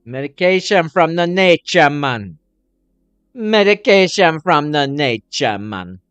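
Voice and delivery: drawn-out, loud call, falling at the end; loud slogan. Acted. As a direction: proclaim it loudly like a slogan. Calls out a slogan.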